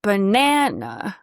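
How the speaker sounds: banana drawn out very slowly, nasal middle; overdone banana. Shown as a demonstration. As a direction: stretch banana, exaggerate the nasal vowel. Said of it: "if I overdo it".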